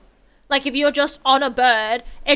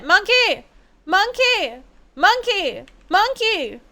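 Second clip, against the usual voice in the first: calling out; 7 semitones higher, slower than usual. Real, not acted. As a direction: yell after the monkey as it runs. Calling out at the monkey.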